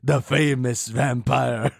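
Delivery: slow, drawn-out mock vampire; famous vampire. The Count asking if his impression is good, slow vampire voice. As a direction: ham it up as an old vampire count. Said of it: "a good impression of the, the famous vampire".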